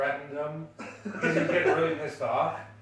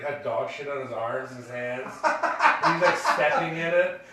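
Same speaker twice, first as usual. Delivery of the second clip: ordinary talk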